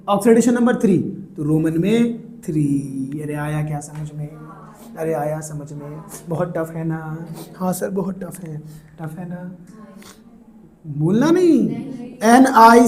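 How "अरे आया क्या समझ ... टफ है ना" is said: soft, low teasing check-in; mock-concerned hush. Teacher playfully asking students if it is very tough, hushed. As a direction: drop low and soft as if commiserating.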